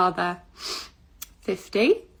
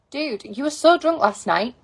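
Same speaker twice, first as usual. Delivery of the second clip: ordinary talk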